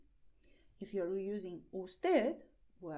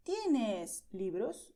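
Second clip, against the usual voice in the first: very slow, clear question; slow direct question; 5 dB louder, much slower than usual. Shown as a demonstration. Teacher modelling asking for books, very slowly. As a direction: drag out each syllable for learners. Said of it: "you also may ask very directly".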